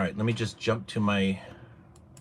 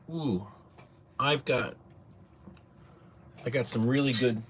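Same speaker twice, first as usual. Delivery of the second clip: normal talking voice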